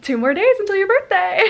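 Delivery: high, loud sing-song with a laugh; excited countdown. Retold or imitated. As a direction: tease it out gleefully. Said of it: "every day I'm like".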